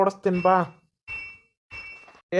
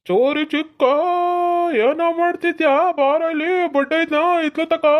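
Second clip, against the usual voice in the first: higher teasing call, as if from across the road; taunting caller; 6 semitones higher than usual. Acted. A prankster calls out to Chori Chikka, high and teasing. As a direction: taunt him by calling out his nickname.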